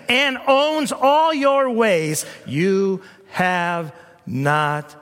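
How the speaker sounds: very slow, rising, every word weighed; solemn build. Acted. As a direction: build slowly toward the climax.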